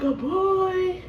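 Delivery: high surprised question; imitating mom's surprise. Mom learning of her first grandson, high surprise. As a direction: ask it in happy disbelief. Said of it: "my mom was like".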